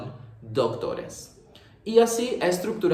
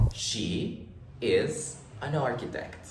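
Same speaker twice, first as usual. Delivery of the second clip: slow, softer, carefully spaced statement; slow model English; 5 dB quieter, slower than usual. Shown as a demonstration. Teacher models the affirmative sentence, slow and softer. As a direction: say it slowly so learners can copy it. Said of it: "¿Cómo hacemos la forma afirmativa?".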